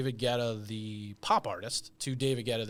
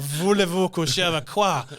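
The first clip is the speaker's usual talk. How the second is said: loud, higher hammed-up French warm-up; French accent; 5 semitones higher, 8 dB louder than usual. Shown as a demonstration. Warming up before quoting the DJ, hammed-up French accent. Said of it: "warm up the French".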